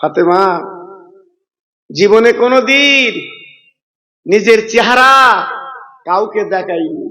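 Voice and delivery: chanted waz narration with long-held line endings; melodic sermon storytelling. Acted. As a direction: intone the story, stretching the last syllables. (সুরেলা ওয়াজের টানা সুরে)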